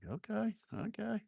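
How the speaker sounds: high, soft rising okays; quiet falsetto-like mock reaction; mock taken aback. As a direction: squeak out a small, high-pitched reply. Retold reaction to her challenge.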